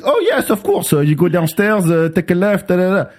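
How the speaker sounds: high-pitched, eager-to-help mock attendant; obliging attendant. French attendant cheerfully gives directions.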